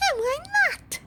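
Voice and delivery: very high, loud shriek; mother shrieking a challenge. Acted. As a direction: snap the question at him. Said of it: "shrieked Missus Gloop".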